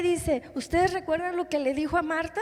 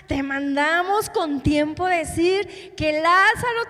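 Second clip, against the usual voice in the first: higher, slowed, insistent complaint; acted-out indignant grievance, building louder; angry reproach; 4 semitones higher, 5 dB louder than usual. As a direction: reproach him as the aggrieved sister, voice raised. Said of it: "Enojada Marta le dice".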